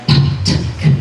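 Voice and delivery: "p, t, k" as voiceless bursts of air; aspirated consonants. Shown as a demonstration. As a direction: puff out "p, t, k" one by one, no voice. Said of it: "you can hear the aspiration".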